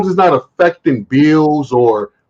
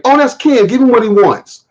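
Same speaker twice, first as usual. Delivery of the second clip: impressed manager; 8 semitones higher than usual. Retold or imitated. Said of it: "we have a guy back here named Ken".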